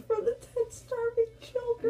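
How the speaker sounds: high laughing answer. Real, not acted. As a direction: giggle the choice out. Laughing while choosing.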